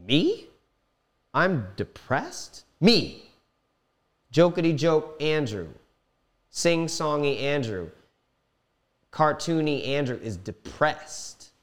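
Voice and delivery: high, loud and incredulous, slowing down; can't believe it's me; stunned disbelief. Retold or imitated. Disbelief at own depression diagnosis.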